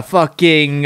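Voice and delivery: normal talking voice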